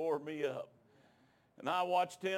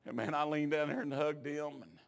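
ordinary talk

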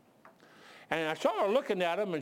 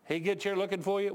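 ordinary talk

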